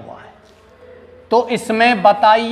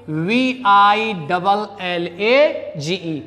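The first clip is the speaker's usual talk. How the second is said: slow, deliberate letter by letter; teacher repeating the spelling slowly; much slower than usual. Shown as a demonstration. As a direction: spell it slowly so students follow. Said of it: "spelling out the word".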